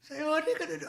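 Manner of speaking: very high cartoon voice; high-pitched goofy sidekick; Shaggy from Scooby-Doo. Co-host praised it, so doing Shaggy voice again. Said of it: "the Shaggy".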